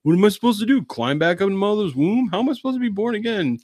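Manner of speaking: very high, hammed-up disbelief; baffled Nicodemus. Nicodemus taking being born again literally, comically incredulous. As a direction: squeal it in mock bewilderment.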